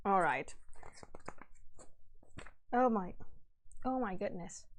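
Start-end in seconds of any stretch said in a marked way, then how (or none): none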